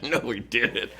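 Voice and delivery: normal talking voice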